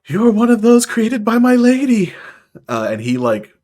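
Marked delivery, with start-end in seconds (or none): 0.1-2.1 high, loud gasp of wonder; thrilled celestial, pitch soaring; awestruck delight. Acted. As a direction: burst out in starry-eyed amazement. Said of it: "the Celestial's eyes just light up".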